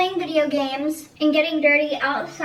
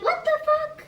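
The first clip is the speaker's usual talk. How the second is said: high-pitched, incredulous mock outcry; retold indignant disbelief; 13 semitones higher than usual. Girl reacting to friend's snub, retold high-pitched disbelief.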